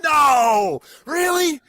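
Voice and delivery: high, loud gasp of disbelief; surprised. Real, not acted. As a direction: react as if truly shocked. Shocked reaction to trivia.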